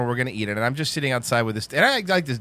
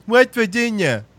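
high, louder slurred janitor voice; the janitor's voice; 7 semitones higher, 4 dB louder than usual. Retold or imitated. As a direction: imitate the janitor's odd slurred speech, pitched up. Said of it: "I am gonna do the voice".

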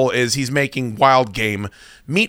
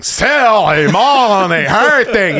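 loud, high, drawn-out mimicry of a radio bit; hammed-up radio catchphrase yell; mock catchphrase holler; 6 semitones higher, 5 dB louder, much slower than usual. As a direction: holler it slowly, stretching every vowel.

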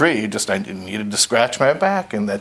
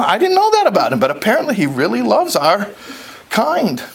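boastful fish; 11 semitones higher than usual. Acted. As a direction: crow about lunch with the king. Lox bragging to his wife.

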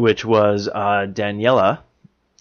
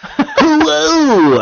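a loud, laughing "Hello!"; laughing shout; 5 dB louder than usual. Real, not acted. Laughing outburst at cohost.

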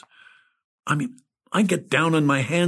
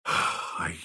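hushed inner voice; 8 semitones lower, 6 dB quieter than usual. Acted. Stunned inner narration.

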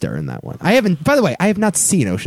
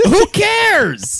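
high, drawn-out exasperated cry; 12 semitones higher than usual. Real, not acted. Defending a silly heist movie, exasperated. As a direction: wail it high, utterly fed up. Heated playful argument over accents.

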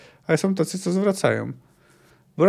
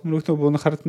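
ordinary talk, nothing marked

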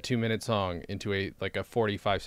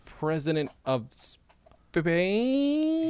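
slow, lingering announcement; drawn-out reveal; 7 semitones higher, much slower than usual. Acted. Host announcing Dan's mock title, drawn-out reveal. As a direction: stretch the words out slowly, Spain longest.

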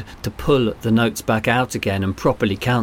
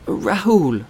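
put-on child voice; 9 semitones higher than usual. A narrator's reading. Boy voiced in story.

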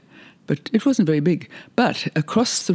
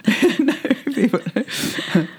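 laughing; 10 semitones higher, much slower than usual. Real, not acted. Laughing at a guest's ordeal.

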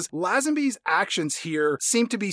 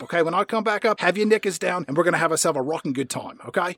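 soft, hurried character patter; mock Bond; 5 dB quieter, faster than usual. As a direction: rattle off formalities under your breath. Playing Bond briskly settling a consent form.

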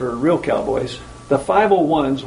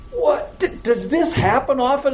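startled CEO, imitated; 9 semitones higher than usual. Retold startled CEO asks cowboys.

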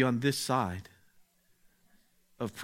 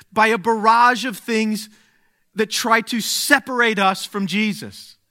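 much higher, louder impassioned sermon; full preaching; 7 semitones higher, 9 dB louder than usual. Acted. Preacher telling congregation the world is hard, impassioned preaching. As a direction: build it up for the crowd.

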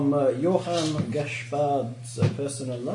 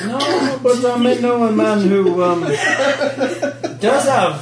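sly teasing; 7 semitones higher, 8 dB louder than usual. Acted. NPC teasing withheld info.

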